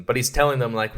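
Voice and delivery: ordinary talk, nothing marked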